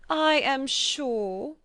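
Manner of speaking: the fretful mother, drawn out slowly; a lightly voiced fussy mother; mother's voice. A narrator's reading.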